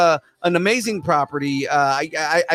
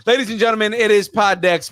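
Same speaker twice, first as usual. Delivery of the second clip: announcer; 6 semitones higher than usual. Acted. Announcer intro.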